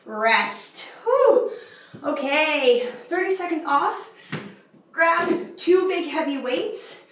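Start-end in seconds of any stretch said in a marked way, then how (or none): 1.0-1.5 relieved whew. Real, not acted. Set just ended.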